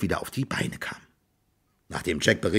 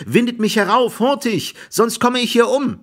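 high, urgent cry for rescue; loud, pleading high-pitched call; shouting; 13 semitones higher, 4 dB louder than usual. A narrator's reading. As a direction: shout up for help. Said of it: "schrie Jack".

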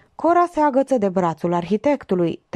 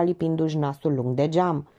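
usual speaking voice

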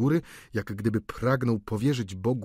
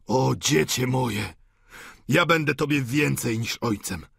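put-on impassioned outpouring to a beloved child; a touch of wild fervour; fervent old father; 3 semitones higher than usual. A narrator's reading. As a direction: gush with mounting adoration. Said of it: "z szalonym jakimś zapałem".

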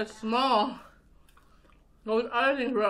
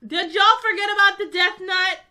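excited shout; 6 semitones higher, 6 dB louder than usual. Real, not acted. Reminding everyone of the challenge.

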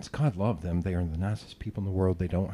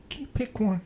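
high, mock-exasperated pleading; joking plea; 9 semitones higher, 5 dB louder than usual. Joking to co-hosts about mixed pronouns, high mock plea. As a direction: plead in a pitched-up voice, as a joke. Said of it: "say this as a joke".

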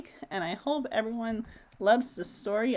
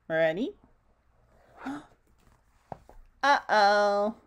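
gasping. Acted. Suspense before the reveal.

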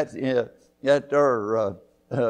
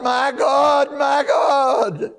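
sung-out cry of my God, high and loud; chanted lament at the top of the voice; anguished cry; 13 semitones higher, 7 dB louder, slower than usual. Acted. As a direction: wail it to heaven. Jesus crying out on cross.

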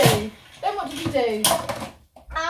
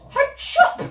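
chopping woodcutter; 10 semitones higher, 4 dB louder than usual. Acted. Woodcutter chops the wolf.

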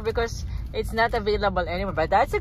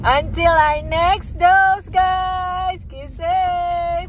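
vlog sign-off; 6 semitones higher, much slower than usual. Acted. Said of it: "vlog sign-off to viewers".

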